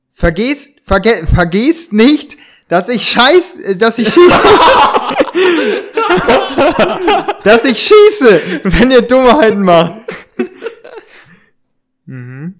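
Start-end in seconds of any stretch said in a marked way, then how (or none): 0.1-9.9 louder, high threat breaking into laughter; laughing threat. Acted. Gunman breaks up laughing mid-threat at the boys. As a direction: try to stay menacing but crack up laughing.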